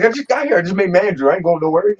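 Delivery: high, mock indignant protest. Telling the boss he just made manager, mock protest. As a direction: insist you're not leaving.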